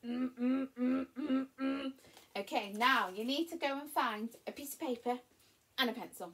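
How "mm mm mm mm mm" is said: repeated humming engine pulses; playful "mm, mm" driving sounds; driving engine noise. Shown as a demonstration. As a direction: hum short bursts like a revving car. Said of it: "Give yourself a driving clap".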